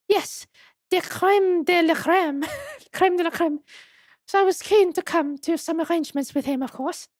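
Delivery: put-on high-pitched madam with French accent; French museum madam. Madam explaining the waxwork's business proposal, high French accent. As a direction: play her high and French.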